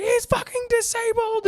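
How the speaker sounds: very high, slow, far-off mock screech; mock shrieking wife. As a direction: stretch it out in a shrill parody. Wife yells about husband.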